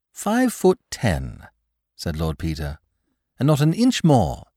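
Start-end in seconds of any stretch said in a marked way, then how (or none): 0.1-1.5 high, slow aristocratic drawl; Lord Peter. Acted. Lord Peter sizing up the bed, slow and high. 3.4-4.4 high, louder aristocratic voice; Lord Peter. Acted. Lord Peter finishing his measurement, high and firm.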